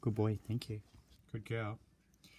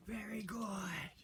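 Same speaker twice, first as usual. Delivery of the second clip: slow breathy whisper of praise; hushing a pet; 4 semitones higher, slower than usual. Real, not acted. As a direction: whisper it so the dogs stay calm. Hushing reactive dogs mid-training.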